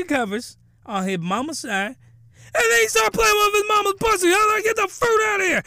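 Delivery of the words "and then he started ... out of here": high, loud, rushed disbelief; shrieking incredulous recap; outraged outburst. Real, not acted. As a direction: blurt it out at full pitch, scandalized. Outraged outburst over shocking plot.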